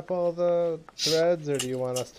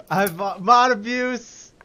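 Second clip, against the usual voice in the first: slow, louder mock outcry; playful outcry; 9 semitones higher, 8 dB louder, slower than usual. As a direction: cry foul playfully. Playfully crying foul.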